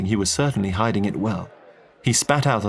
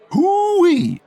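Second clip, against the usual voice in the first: high, loud Texan whoop of delight; excited whoop; 7 dB louder than usual. Acted. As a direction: let out a big hoo-wee. Texan hears about a princess.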